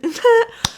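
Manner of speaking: sudden high laugh; bright laugh. Real, not acted. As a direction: burst out laughing. Laughs at the clip.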